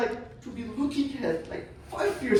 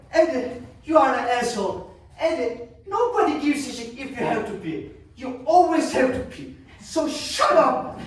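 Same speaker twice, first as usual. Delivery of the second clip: mother's exasperated scolding; 2 semitones higher, 3 dB louder than usual. Acted. Acts out mother berating father.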